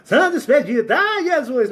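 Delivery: high, pleading film-style prayer to the saints; mock desperate plea. Soap character in trouble begging saints, hammed-up high plea. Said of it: "Tá passando por dificuldade".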